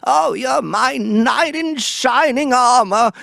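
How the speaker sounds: put-on high, slow trembling old-lady voice; old Hollywood dame, slow and high; Katharine Hepburn. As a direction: play an aged grand dame doting on her son. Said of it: "like Katharine Hepburn on On Golden Pond".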